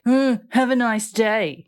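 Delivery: huffy, drawn-out mock snub; with attitude. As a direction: huff first, then stretch it out with attitude. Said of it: "You probably said it like".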